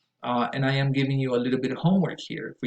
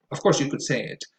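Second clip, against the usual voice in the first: normal talking voice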